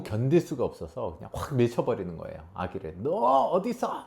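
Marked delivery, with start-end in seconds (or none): 3.0-4.0 put-on high sharp call at the baby; mock shout at baby. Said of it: "막 이렇게요".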